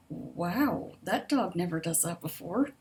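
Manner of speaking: ordinary delivery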